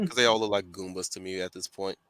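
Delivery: usual speaking voice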